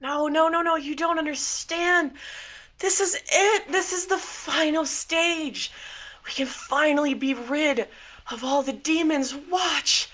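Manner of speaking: high-pitched, manic insistence; unhinged Miracle figure, fervent. Acted.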